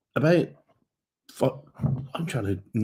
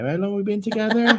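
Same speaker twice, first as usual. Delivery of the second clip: high calling voice from another room; shouting up the stairs; 11 semitones higher, faster than usual. Retold or imitated. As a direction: call it up the stairs, quick and high. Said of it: "She shouted up the stairs to me".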